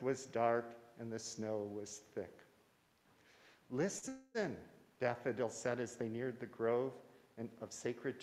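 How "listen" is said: hushed fairy voice. Acted. Fairy hears singing in grove.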